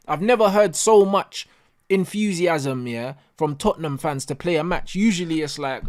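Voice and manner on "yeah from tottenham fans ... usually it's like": ordinary talk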